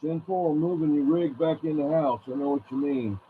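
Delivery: ordinary talk, nothing marked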